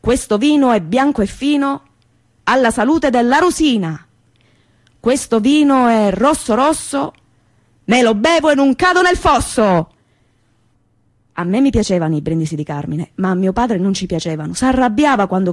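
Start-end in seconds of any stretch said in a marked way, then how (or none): 0.0-9.9 slow, declaimed wedding toast in verse; rhyming toast. Retold or imitated. Barista toasting at a wedding, slow rhymed declamation. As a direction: raise the glass and recite like a poet. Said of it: "come brindisi sembrava un poeta".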